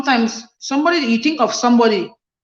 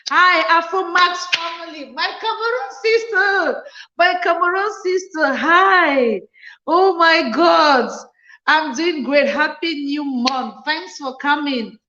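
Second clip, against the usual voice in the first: excited greeting; 7 semitones higher, slower than usual. Real, not acted. Greeting a viewer joining the live.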